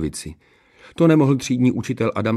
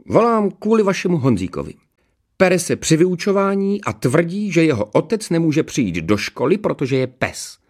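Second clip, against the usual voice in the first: officious headmaster; 9 semitones higher than usual. A narrator's reading. Headmaster phoning a mother about her son, officious. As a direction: report the misdeeds in a high voice.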